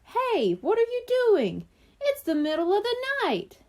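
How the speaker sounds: put-on startled boy squawks from his bed; squawking twin. A narrator's reading. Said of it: "squawked from the next bed".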